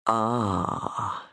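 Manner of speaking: low, soft sinister murmur. A narrator's reading. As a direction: murmur it like a lurking monster. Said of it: "he murmured".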